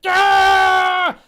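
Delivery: loud high shriek of panic; panicked scream. Acted. Jammer screams as ship crashes into planet.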